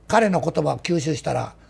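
ordinary talk

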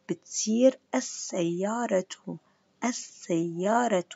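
السيارة slowly with no l, doubled s: assayyara; sun lam slowly. Shown as a demonstration. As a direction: lean on the double s. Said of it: "بحط شدة على الحرف".

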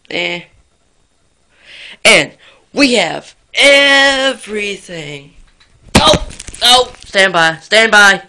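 loud chaotic yelling; shouting. Acted. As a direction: yell it out frantically.

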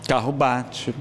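usual speaking voice

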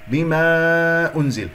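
بما with a long held aa before أنزل; madd demonstration. Shown as a demonstration. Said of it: "مد جائز منفصل بمقدار حركتين".

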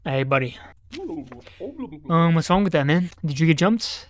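ordinary delivery

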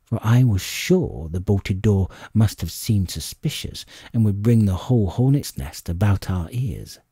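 ordinary delivery, nothing marked

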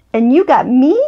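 ordinary delivery

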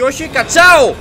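sudden high panicked shout; scared yell. Real, not acted. Gamer jolted by a monster, panicked yell. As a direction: yelp in real fright at a jump scare. Startled in horror game.

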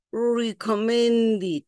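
very slow "recommended" ending in "dit". Shown as a demonstration. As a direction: stretch "recommended", last vowel an I. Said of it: "la E va a cambiar por I".

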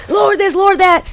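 high-pitched mock angry church lady. Imitating the church lady's outburst, angry. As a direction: imitate her throwing the Lord around angrily. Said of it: "her voice sounded so angry".

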